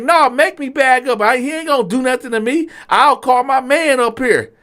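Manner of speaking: high, loud dare; lightly voiced waiter daring him; taunting waiter. Waiter taunting the big diner, high and loud.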